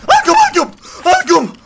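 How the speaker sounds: very high, loud triumphant yell; excited shout. Real, not acted. Gamer landing shots, excited yelling. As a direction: scream in triumph while firing.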